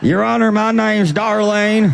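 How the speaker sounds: Judge Judy plaintiff. Retold or imitated. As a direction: play a brash Wawa-working litigant. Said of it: "Beetlejuice characters".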